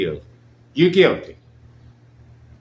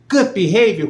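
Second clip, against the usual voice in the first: higher, louder outraged disbelief; incredulous; 8 semitones higher, 5 dB louder than usual. Real, not acted. Host repeating the excuse to listeners, incredulous and loud. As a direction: spit it back as if it can't be true.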